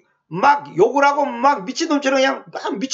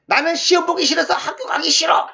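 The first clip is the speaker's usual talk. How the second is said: put-on higher-pitched kid refusing to go to school; mock childish protest; whiny schoolchild; 5 semitones higher than usual. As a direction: whine it like a child digging in their heels.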